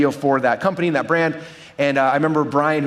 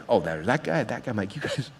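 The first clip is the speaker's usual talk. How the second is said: lower, softer amused mutter; under-breath aside; 6 semitones lower, 8 dB quieter than usual. Retold or imitated. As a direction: recognize them and mutter it to yourself. Spotting lobby pitchers, under breath.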